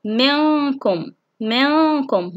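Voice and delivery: slow منكم with long nasal noon; ikhfa demonstration. Shown as a demonstration. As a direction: hum the noon before the ك of منكم. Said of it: "حكم إخفاء من كلمة واحدة". (إخفاء النون بغنّة ممدودة في منكم)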